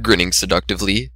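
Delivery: ordinary delivery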